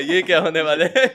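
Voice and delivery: mock alarm, half laughing; laughing "what's about to happen?"; amused disbelief. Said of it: "मैंने कहा".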